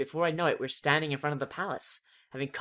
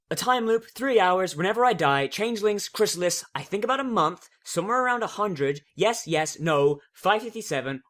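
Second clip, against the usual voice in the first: rapid-fire answers; 4 semitones higher, 6 dB louder than usual. Acted. Twilight rattles off rapid answers.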